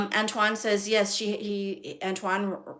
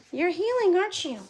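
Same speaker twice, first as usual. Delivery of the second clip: doting dog talk; 10 semitones higher than usual. Real, not acted. Breeder to her dog healing after surgery, high doting baby talk.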